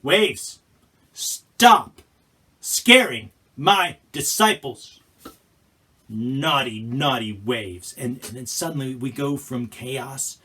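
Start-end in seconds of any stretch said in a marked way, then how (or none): 0.0-7.9 loud, slow mock scolding, like to a pet; scolding waves. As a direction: tell off naughty waves playfully. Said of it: "he stands up and he's like".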